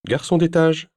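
high, loud call from outside; calling through the door. Acted. Bellboy announcing himself through the hotel door. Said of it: "lança une voix à travers la porte".

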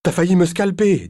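high, loud startled shriek; outraged yell. Acted. Ex-wife yelling at him after being hauled from the bath. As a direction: shriek it at him, caught off guard. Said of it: "elle poussa un hurlement".

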